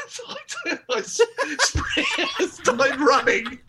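high giggles, words stretched slowly; laughing. Real, not acted. Recounting running from the heckler, laughing. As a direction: laugh out the escape.

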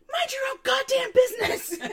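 high, loud laughing yell; laughing shout. Retold or imitated. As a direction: yell it at him through laughter. Retold yelling at man.

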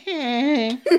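high, thin honk; imitated weak car horn. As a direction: mimic a feeble car horn. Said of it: "And he's like".

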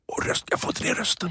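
breathy, half-voiced croak; lost voice. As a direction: struggle to get the voice down. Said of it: "Jag tappar rösten". (hes röst som inte bär)

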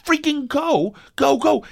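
frustrated driver urging the woman ahead to hurry; exasperated inner rant. Retold or imitated. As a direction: urge her to just go, exasperated. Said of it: "And I'm like".

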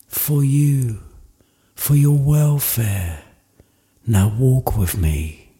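soft, slow, hushed spirit voice; quiet, unhurried ghost guiding Scrooge; childlike spirit. Acted. Narrator voicing childlike spirit.

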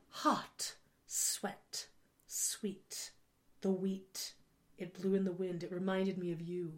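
hushed, whispery spoof of pretentious stage poetry; mock theater monologue. Actor performing in a Brooklyn theater show, mock whispered monologue. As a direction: whisper it softly, word by word, parodying an actor's monologue. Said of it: "it was just, like, monologues like that".

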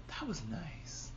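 very soft, slow murmur of awe; awed whisper. Retold or imitated. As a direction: drop right down, slow and hushed. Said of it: "he even whispered his attack".

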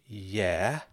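drawn-out, reluctant yes; oddly guarded yeah. Acted. Frank asked if he likes Hamilton, oddly guarded. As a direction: agree without meaning it, dragging the word. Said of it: "Why are you saying it like that?".

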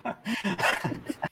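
an unvoiced, soft laugh; soft silent laugh at the joke; breathy laugh. Real, not acted. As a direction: laugh quietly under your breath.

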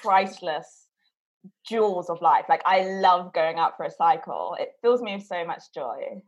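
normal talking voice

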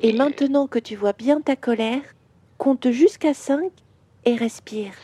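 calm questions with long pauses, as a gentle father; soothing guided visualisation. Acted. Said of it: "Je vais te parler comme me parle mon papa".

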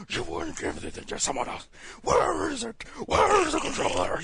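overdone hoarse superhero voice; raspy mock interrogation building to a high, loud yell; Batman. Mocking the fighting scene, raspy yell that builds. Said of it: "where he's just going into".

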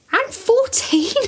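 slow, high mock whine; high whiny voice. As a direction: whine it like a child.

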